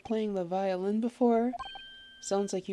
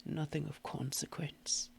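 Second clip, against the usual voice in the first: much lower, soft, whispered gent; brooding gentleman; 5 semitones lower, 10 dB quieter than usual. Acted. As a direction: mope as the gloomy detective. Deep detective voice.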